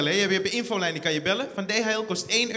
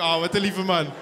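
higher, louder gushing thanks; exaggerated sugary goodbye; mock sweet farewell; 6 semitones higher, 8 dB louder than usual. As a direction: coo at the nice driver.

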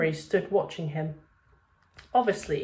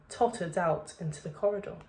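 ordinary delivery, nothing marked

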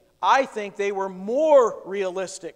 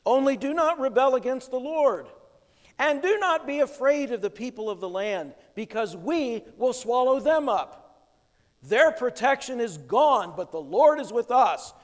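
raised, louder preaching read; preaching scripture; 5 semitones higher, 4 dB louder than usual. Acted. Preacher reads Joshua's charge to the congregation, raised and louder. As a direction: proclaim the verses with force.

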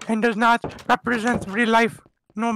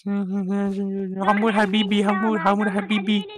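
mock Arabic. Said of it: "I do not know how to speak Arabic".

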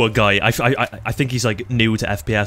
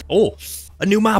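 high, delighted outburst; excited exclamation; 9 semitones higher than usual. Real, not acted. Player spotting a new map, excited. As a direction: pitch jumps up in surprise.

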